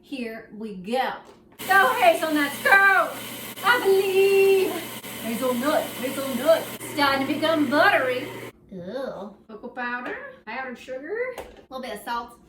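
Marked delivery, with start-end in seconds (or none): 1.7-4.7 loud, high cheerleading shout; mock-fervent yelled encouragement; cheering on. Cheering hazelnuts over the processor. 5.2-8.3 loud, raised voice over a machine; loud playful commentary; calling over noise. Real, not acted. Calling out over the food processor.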